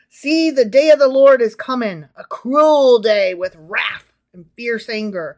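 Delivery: loud, stretched prophetic warning; hammed-up preacher-like declaiming; dramatic scripture reading. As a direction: proclaim doom with heavy emphasis. Reads scripture aloud to co-host.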